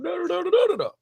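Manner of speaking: high mock gibberish rant. Stranger ranting on and on in the street, high mock babble. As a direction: babble it high to stand in for the rest of his rant. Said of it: "He's like".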